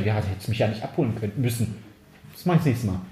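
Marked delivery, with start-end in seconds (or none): 0.0-1.8 lower, softer whining sister; a hint of a sulky whining sister; whiny sister. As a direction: moan it sulkily. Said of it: "da jammert die".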